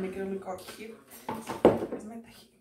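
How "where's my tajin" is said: ordinary delivery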